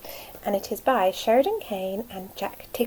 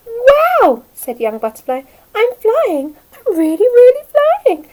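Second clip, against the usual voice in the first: very high, loud squeal of joy; thrilled; 11 semitones higher, 12 dB louder than usual. Acted. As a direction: shout it with pure delight. Butterfly thrilled to fly.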